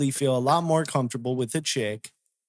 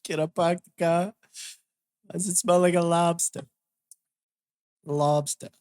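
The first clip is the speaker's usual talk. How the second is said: put-on Boston accent. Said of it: "I can't do the Boston accent".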